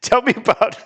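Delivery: words squeezed out through laughter; giggling into the next question; laughing. Real, not acted. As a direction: still laughing as he starts the question. Laughing after soda joke.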